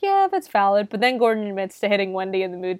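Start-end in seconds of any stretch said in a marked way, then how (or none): 0.0-0.9 high-pitched little voice of agreement; mock agreement. Viewer agreeing with Phil's regret, high mock concession.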